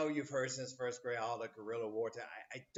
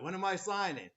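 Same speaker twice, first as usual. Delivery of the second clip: a hammed-up young officer, higher and louder; mock eager Washington; 8 semitones higher, 6 dB louder than usual. Teacher voices naive Washington, mock eager and high.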